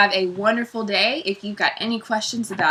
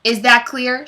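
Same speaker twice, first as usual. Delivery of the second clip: raised, insistent question; stern demand; 5 semitones higher, 6 dB louder than usual. A narrator's reading. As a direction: press the pupil, louder and higher. Teacher repeats demand.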